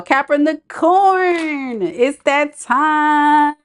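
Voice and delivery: high, long-held call; singsong announcing. Real, not acted. Host calling Capricorns to their reading, singsong announcing. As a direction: stretch each word out like a roll call. Calls up the next sign.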